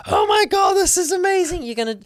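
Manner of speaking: very high, loud excited squeal; excited screaming. Retold or imitated. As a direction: shriek it like thrilled news on the phone. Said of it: "And now Allison's screaming".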